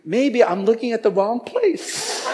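slow, high-pitched musing; mock pondering. Man wondering about the wrong place, mock pondering. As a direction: muse aloud, slowly and up high. Imagined reasonable thought, acted.

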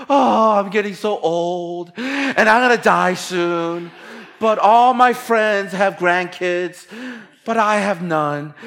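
milk the guilt, slowly, up high; passive aggressive parent. Retold or imitated. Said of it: "passive aggressive".

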